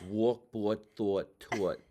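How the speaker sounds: broad Jersey "aw" in each word; New Jersey accent. Shown as a demonstration. Student reading words to acting teacher, New Jersey accent. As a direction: say the word list in thick New Jersey vowels.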